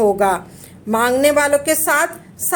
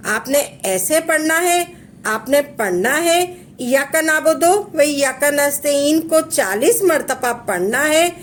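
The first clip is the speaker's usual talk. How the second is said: ordinary talk, nothing marked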